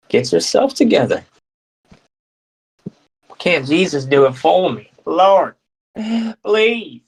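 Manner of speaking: high, hammed-up prayerful wail; mock pleading. Someone begging Jesus to do it for them, mock pleading. Acted plea to Jesus in accent.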